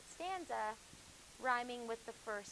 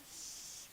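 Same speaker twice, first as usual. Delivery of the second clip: hush; 10 dB quieter than usual. Real, not acted. Hushing chatty students.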